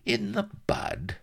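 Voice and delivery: high-pitched pondering; musing. A narrator's reading. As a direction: muse aloud in a high voice. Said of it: "mused Vladimir".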